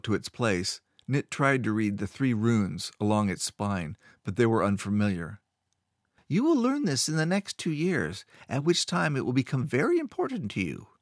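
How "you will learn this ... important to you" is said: a hint of a lofty wizard, higher; lightly voiced old master; stern master. Master lectures the boy.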